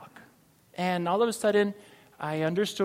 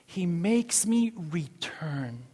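usual speaking voice

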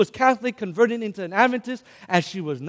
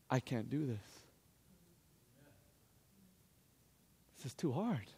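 very soft, lowered inner voice; hushed despair; 4 semitones lower, 15 dB quieter than usual. Retold or imitated. Grandson alone in his car before facing grandmother, hushed despair. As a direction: let it sink into quiet defeat.

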